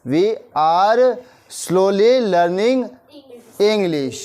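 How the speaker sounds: very slow, each word spaced out for learners; slow dictation. Shown as a demonstration. Teacher to Hindi-speaking class, slow clear English model sentence. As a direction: spell it out slowly so the class can follow. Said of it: "क्या बोला मैंने?".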